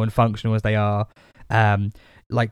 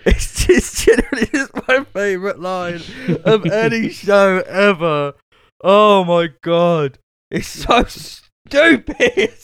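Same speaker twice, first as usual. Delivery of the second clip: high, slow words through giggles; barely getting words out through laughter; laughing while talking; 7 semitones higher, slower than usual. Real, not acted. Fan gushing over favourite Scrubs line, laughing.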